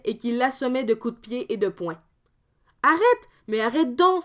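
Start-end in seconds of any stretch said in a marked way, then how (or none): 2.8-4.2 high, louder pleading cry; crying out to stop. Acted. Pierre begging his brother to stop, high cry. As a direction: beg him to stop hitting you. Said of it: "s'écria le fantôme".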